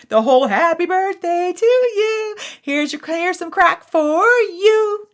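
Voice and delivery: high, loud sing-song mockery; mock the birthday cheesecake ritual; sarcastic mockery. Mocking the birthday song and cheesecake, high sing-song sarcasm.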